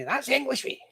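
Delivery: mock granny shout. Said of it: "my granny always used to shout at me".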